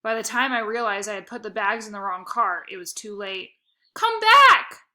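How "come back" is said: full scream, much higher and louder. Acted. Boy screaming after the driving-away convertible, full scream. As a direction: yell desperately after a leaving car. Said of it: "I screamed".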